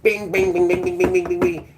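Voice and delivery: high sing-song bing bing piano noises; piano plinks. Retold or imitated. Mimics piano playing.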